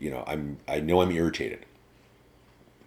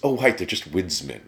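ordinary talk

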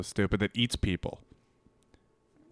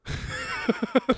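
helpless high-pitched laugh; 12 semitones higher, 6 dB quieter than usual. Real, not acted. Reading his texts, cracking up.

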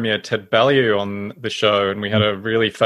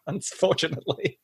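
laughing; 5 semitones higher, 8 dB quieter than usual. Real, not acted. Laughing at mayor's remark.

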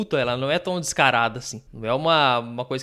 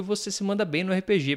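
usual speaking voice

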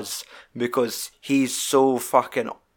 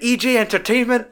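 high accusing callout; mock rant; 11 semitones higher, 4 dB louder than usual. Film dad calling out the men, mock accusation. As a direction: imitate an outraged dad calling them out. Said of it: "it's his fucking rant".

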